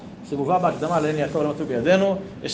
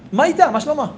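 high, lively hello; excited greeting; 10 semitones higher, 4 dB louder than usual. Acted. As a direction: call out cheerfully on coming in. Husband greeting at the door.

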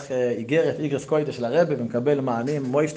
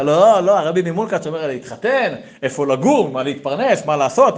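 louder, higher, insistent objection; agitated protest; 4 semitones higher, 6 dB louder than usual. Acted. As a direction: protest urgently, pushing each question. Acted protest to the Rebbe.

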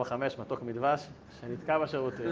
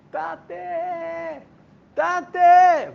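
put-on high piercing child's call to his father; little child calling; 14 semitones higher, 8 dB louder, much slower than usual. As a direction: shout it like a small boy locked in. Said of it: "ממש קול גבוה כזה, דק". (קריאת ילד קטן, גבוהה וחזקה)

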